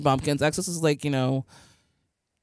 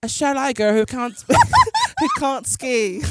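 a hint of a mock British accent, higher, broken by a laugh; bad British accent; 8 semitones higher than usual. Friend attempting the same line in a British accent. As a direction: try the British line and crack up. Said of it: "I'm terrible too".

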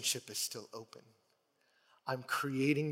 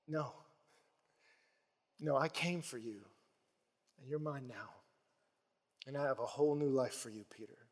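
tender reassurance; 6 dB quieter than usual. Retold or imitated. Jesus forgiving Peter after failure.

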